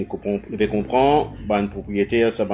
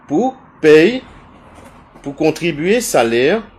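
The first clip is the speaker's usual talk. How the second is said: indignant outburst; 5 semitones higher, 6 dB louder, slower than usual. Real, not acted. Indignant about public money.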